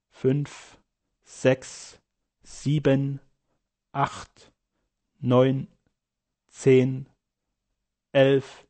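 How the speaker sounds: slow monotone count with even gaps; distant, regular counting. A narrator's reading. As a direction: count evenly, sinking under anaesthetic, as if from afar. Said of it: "wie aus der Ferne".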